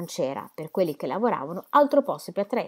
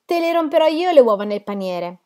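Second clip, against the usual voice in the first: higher, louder card-player's outburst; loud cry; 6 semitones higher, 5 dB louder than usual. A narrator's reading. Card player challenging his opponent, loud cry. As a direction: call it out loudly across the table. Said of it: "Gridò forte".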